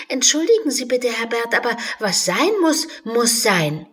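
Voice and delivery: put-on fast, high girlish apology; quick apology. Alice apologizes to the unicorn quickly but firmly. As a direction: hurry through a polite sorry.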